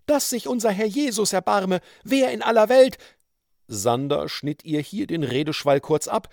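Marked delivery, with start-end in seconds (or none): 0.0-3.0 high, scandalised matron, building louder; indignant woman. A narrator's reading. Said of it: "die entrüstete Stimme der ehrsamen". (entrüstete, hohe Frauenstimme, lauter werdend)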